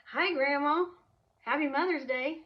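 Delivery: high, loud, cheeky greeting; cheeky husband. Retold or imitated. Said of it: "Thinking he's being funny".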